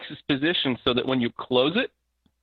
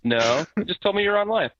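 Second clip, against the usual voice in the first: higher mock mother voice, puzzled; imitating mom; 7 semitones higher than usual. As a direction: play his confused mother, pitched up. Son voicing his mother on Skype.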